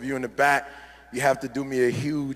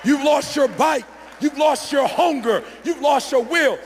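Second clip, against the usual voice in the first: much higher, loud, slow shouting; preaching shout; 8 semitones higher, 5 dB louder, slower than usual. Real, not acted. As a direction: hammer each line home from the pulpit. Preacher driving point home.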